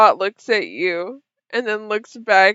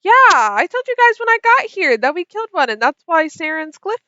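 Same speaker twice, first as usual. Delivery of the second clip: Kira's voice; 7 semitones higher, 5 dB louder than usual. Acted. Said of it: "Kira says".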